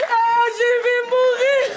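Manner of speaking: high, loud, slow mock death wail; melodramatic wailing. Mother threatens to die over daughter's boyfriend, hammed-up wail. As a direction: wail as if dying of shame.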